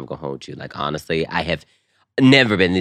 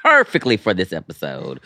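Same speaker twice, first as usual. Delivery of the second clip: ordinary talk, nothing marked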